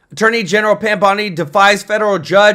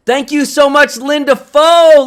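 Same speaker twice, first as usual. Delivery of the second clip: excited thanks; 6 semitones higher, 4 dB louder than usual. Real, not acted. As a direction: thank the donor with big energy. Excited thanks for super chat.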